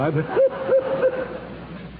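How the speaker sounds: breathy high-pitched giggle; high silly giggle. Acted. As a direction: giggle at your own joke, way up high. Ed Wynn's trademark giggle.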